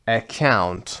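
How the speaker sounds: ordinary talk, nothing marked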